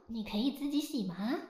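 ordinary talk, nothing marked

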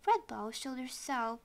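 usual speaking voice

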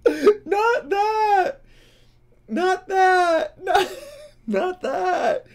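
high, loud, laughing squeal; real helpless laughter. Real, not acted. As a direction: struggle to get it out through laughter. Cracking up at the clip.

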